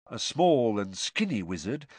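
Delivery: usual speaking voice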